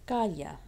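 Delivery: "Calla" as CA-ya; strong "Ca" in "Calla", then falling; stress on CA. Shown as a demonstration. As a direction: lean on the first syllable of "Calla". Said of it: "but the ca is not".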